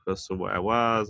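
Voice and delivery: normal talking voice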